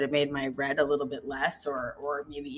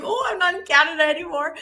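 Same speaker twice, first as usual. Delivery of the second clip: high, laughing realization; laughing dismay; 13 semitones higher, 6 dB louder than usual. Retold or imitated. Husband realizing Rome isn't Canada, laughing. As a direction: giggle through the sudden realization. Husband stunned by gruff barista.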